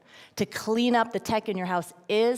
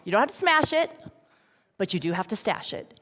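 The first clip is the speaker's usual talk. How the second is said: usual speaking voice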